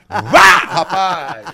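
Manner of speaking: slow laughing shout. Real, not acted. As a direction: bellow it out through laughter. Grito rindo, lento. (grito lento entre risadas)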